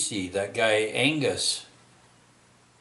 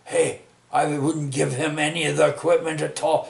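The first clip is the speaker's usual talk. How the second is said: firm refusal; 5 semitones higher, 3 dB louder than usual. Retold or imitated. Retelling his own firm refusal.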